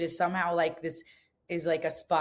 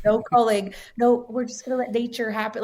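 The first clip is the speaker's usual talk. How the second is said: higher, louder mock-idealist voice; mocking naive self; 5 semitones higher, 5 dB louder than usual. Quoting her past vegan self, loud mock-idealistic. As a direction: ham up your old hands-off idealism. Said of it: "when I had been like".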